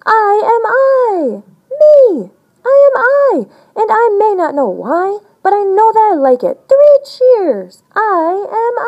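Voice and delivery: high, loud, slow joyous shout at the sky; child shouting to the sky, triumphant; shouting joyfully. Acted. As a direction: yell it to the sky. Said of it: "Shout loud at the top of your voice".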